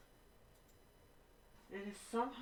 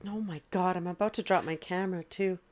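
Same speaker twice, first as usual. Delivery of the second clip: louder, fed-up exclamation; real exasperated outcry; loud frustration; 12 dB louder than usual. Real, not acted. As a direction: let it burst out in exasperation. Tech failing, frustrated exclamation.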